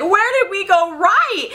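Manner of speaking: high, drawn-out lament; theatrical bewildered cry; mock despair. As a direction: wail it slowly in hammed-up dismay. Acted dismay at the hit.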